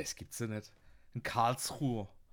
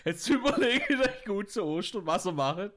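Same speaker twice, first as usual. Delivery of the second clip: laughing; 6 semitones higher, 4 dB louder than usual. Real, not acted. Laughing after joke.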